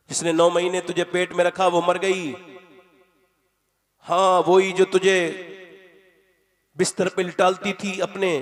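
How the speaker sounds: full-force sermon about the mother's love; impassioned preaching. Acted. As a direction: preach it with long pauses for effect.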